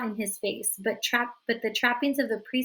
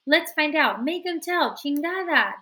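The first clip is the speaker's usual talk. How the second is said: mob urging; 6 semitones higher, 5 dB louder than usual. A narrator's reading. Kids ganging up on Florence.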